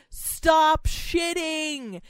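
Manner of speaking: high, punched mock shout; imitated shouting. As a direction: imitate a man shouting at his cat. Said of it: "apparently he was shouting".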